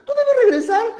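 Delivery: high, insistent put-on voice; moon instructing. Moon makes Takainamo promise to return, high and insistent.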